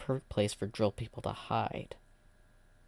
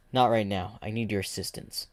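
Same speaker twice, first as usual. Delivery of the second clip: plain reading voice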